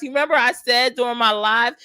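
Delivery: ordinary talk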